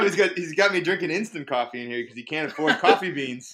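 loud, high, through laughter; laughing complaint. Real, not acted. Telling friends about being given instant coffee, laughing. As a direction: complain while cracking up.

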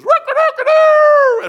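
a high, loud, slow rooster crow. Retold or imitated. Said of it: "that rooster goes".

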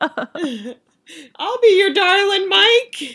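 overdone teary gush of devotion; mock swoon. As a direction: melt over Mike like a besotted fan. Swooning fan mock reply to Mike.

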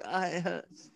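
soft, high whiny moan; mock-pitiful complaint to his wife about sore arms; pained whimper.

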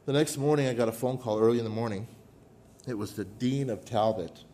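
normal talking voice

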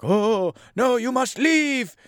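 high, drawn-out mock dread; hammed-up "get out of here" alarm; mock alarmed warning. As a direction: imitate a dramatic fortune teller's warning.

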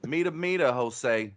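Southern twang mangling Spanish; horrible Southern-accented Spanish. Acted. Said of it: "a man with a horrible accent".

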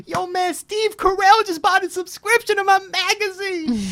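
very high, loud, drawn-out mock scream; retold excited screaming. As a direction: imitate a seller screaming news down the street. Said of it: "screaming down the street".

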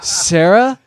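high, loud, rising question; baffled surprise. Retold or imitated. Drunk Chris thinking he sees Sarah, baffled surprise. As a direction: imitate a stunned double-take on the name.

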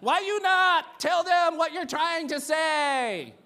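put-on very loud, high, drawn-out Filipino-accented scolding; mock Filipino accent. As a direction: wail it slowly as a hammed-up Filipino relative. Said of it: "reconnecting with my heritage lately".

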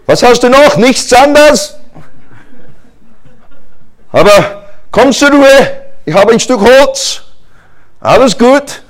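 high, loud hammed-up dialogue; mock panicky exchange. Israelites doubting Moses's stick, loud comic back-and-forth. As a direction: play both the crowd and Moses.

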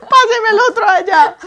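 high, loud laughing wail; hammed-up sobbing request; mock crying. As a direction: beg for tissues through fake tears. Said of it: "Empiezo a llorar".

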